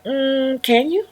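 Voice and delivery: high, hesitant ask; sheepish request. Retold or imitated. Vlogger asking the owner to put the dog up, sheepish. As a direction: ask timidly with a hopeful lift.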